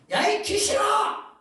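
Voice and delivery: slow, high, loud shout at a demon; rebuking shout. Acted. Preacher voicing a rebuke to a demon, furious slow shout. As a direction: bellow it down at the spirit. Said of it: "라고 꾸짖어".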